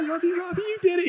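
very high, gushing sweetheart; hammed-up squeaky film heroine; Adrian. Imitates Adrian praising Rocky.

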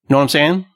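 "Know what I'm saying?" run together, rising; slurred colloquial phrase. Retold or imitated. Giving relatives an example of American English, slurring "Know what I'm saying?". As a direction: slur "Know what I'm saying?" into one quick question. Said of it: "someone will say".

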